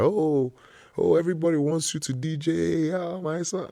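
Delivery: high, fast proud parent. Retold or imitated. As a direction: gush like a proud mom. Parents voiced proudly in story.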